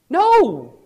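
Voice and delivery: sudden loud, high no; a forceful preacher's no; emphatic no. Real, not acted. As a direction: shut the idea down hard. Preacher rejects a joking claim.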